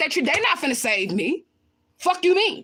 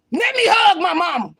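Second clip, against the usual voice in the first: loud, higher, drawn-out plea; impassioned shout; 4 semitones higher, 5 dB louder than usual. Real, not acted. Daughter demanding to hug her mama, impassioned shout. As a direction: shout it slowly, every word a demand.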